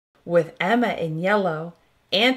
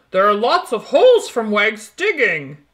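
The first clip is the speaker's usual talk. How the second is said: calling out; 6 dB louder than usual. Acted. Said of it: "calls Simon".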